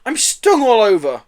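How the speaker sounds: high, loud, aggrieved wail; furious complaint. A narrator's reading. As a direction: complain in outrage. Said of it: "a furious Joe".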